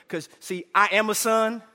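usual speaking voice

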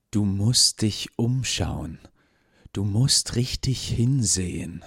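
slow, intent leader voice; slow and insistent; lightly voiced leader. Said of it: "Er sagte".

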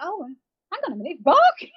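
sudden realisation. Retold or imitated. Realising the dog warns of bears.

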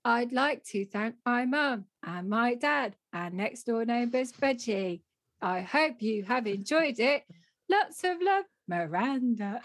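slow, stilted mock recorded thank-you; over-enunciate it like a homemade advert; amateur car-advert delivery. Author recording her acknowledgements, stilted advert-style reading. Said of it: "You know, it was like that".

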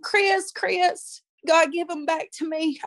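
high anguished retold cry; retold desperate scream. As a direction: call out to him then plead with God. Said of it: "You know, I screamed".

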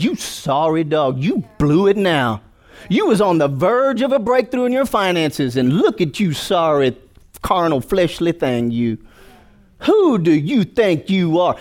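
slow, contemptuous sneer; sneering devil. Retold or imitated. Devil sneering at the fallen believer, slow. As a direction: drag out the insult slowly. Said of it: "Now he's like".

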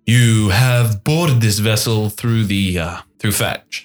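slow, drawn-out captain's voice; a captain questioning boarders, slow and deliberate; elven captain. Acted. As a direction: take your time, lord of the ship. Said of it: "He looks to you and he says".

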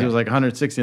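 normal talking voice

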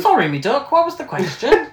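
high, loud mock British dialect; British regional accent. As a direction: pipe it up in a hammed-up British accent. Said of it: "No, British".

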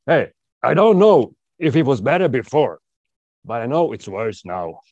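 imitating a heckler's loud shout; retold heckler shout. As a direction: call it out like a heckler in the back row. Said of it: "so he, he shouts".

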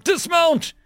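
very high, loud yell; shouted order. A narrator's reading. Bradford shoves her door open, shouting to dismount. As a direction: repeat the command at a high, urgent shout. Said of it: "Bradford shouted, shoving her door open".

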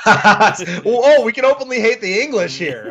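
laughing. Real, not acted. Laughing at a joke.

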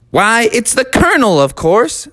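a tiny host stating the obvious; Candy Bar Carl. Acted. Carl answering Froggy, high cartoon matter-of-fact. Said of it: "said Candy Bar Carl".

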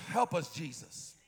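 ordinary delivery

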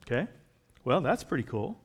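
normal talking voice

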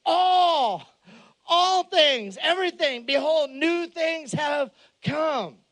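high, loud, slow preaching shout; preaching at full force. Acted. Pastor proclaiming the verse to the congregation in a loud, slow shout. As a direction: proclaim it to the back row.

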